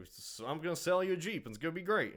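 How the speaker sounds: lightly voiced Southern car salesman; uncle's accent. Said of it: "my uncle sounds like this".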